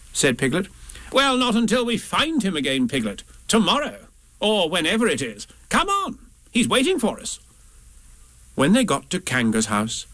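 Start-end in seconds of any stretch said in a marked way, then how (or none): 1.1-7.4 high, brisk-mannered Rabbit voice; hurried Rabbit. Acted. Rabbit urges Piglet to come on, high character voice. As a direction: pitch it up as Rabbit chivvying a friend along.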